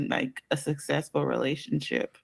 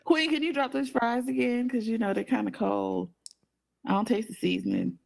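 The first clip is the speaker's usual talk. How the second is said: mock fool; 6 semitones higher than usual.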